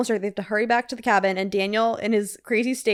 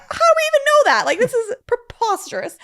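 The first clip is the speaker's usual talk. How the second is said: mock-crying oh my God, then higher and higher in outrage; exasperated disbelief; 6 semitones higher, 4 dB louder than usual. Host reacting to the movie, exasperated mock-cry rising to high outrage. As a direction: whine it at the screen in disbelief. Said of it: "And I'm like".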